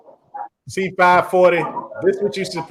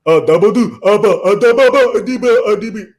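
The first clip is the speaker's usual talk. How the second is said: loud, higher mimicry of an African accent; mock African accent; 7 semitones higher, 7 dB louder than usual. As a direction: gibber loudly in a put-on African accent. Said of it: "they're talking like that".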